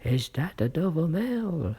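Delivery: a loud, high hail from far off; calling from the mist. A narrator's reading. The rider in the mist calls out to the coach. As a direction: call out high and loud across the distance. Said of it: "a man's voice called from the mist".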